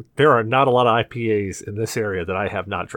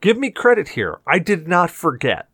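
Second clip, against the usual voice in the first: indignant protest; 7 semitones higher than usual. Real, not acted. Protests he remembered the questions.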